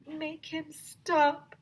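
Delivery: high, slow begging plea. Acted. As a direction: plead slowly in a high kid's voice. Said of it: "begged Neil the new kid".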